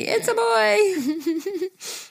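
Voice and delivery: high, very slow, squeaky through laughter; laughing. Retold or imitated. Host repeating the listener's turkey yell, laughing hard. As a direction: squeeze it out while cracking up.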